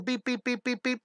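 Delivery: high, repeated little beeps; imitated beeping. Teacher joking about stress on the bus, playful beeping. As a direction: beep like a toy bus horn.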